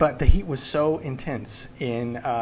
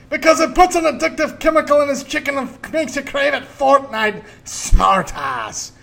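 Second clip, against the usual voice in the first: put-on ranting Scottish accent; 11 semitones higher, 10 dB louder than usual. Dad scolding his son, in a loud Scottish accent. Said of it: "doing this Scottish accent".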